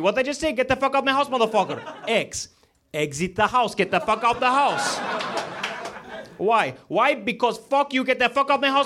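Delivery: high-pitched cursing character reciting the alphabet; high, insistent get-out-of-my-house character; foul-mouthed character. Acted. Comic performing an alphabet piece for the crowd, high cursing character voice. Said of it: "accuracy of that character".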